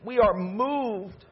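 ordinary talk, nothing marked

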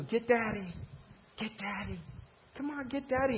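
put-on high, teasing call to a toddler; playful coaxing. Dad coaxing his kids to chase him, high and playful. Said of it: "What do you do?".

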